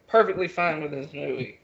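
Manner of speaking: normal talking voice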